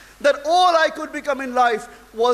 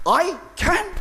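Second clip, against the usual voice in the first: higher, startled question, retold; a mild echo of startled wonder; reliving the boy's surprise at the mentor's words, startled; startled disbelief; 6 semitones higher than usual. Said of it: "I was startled".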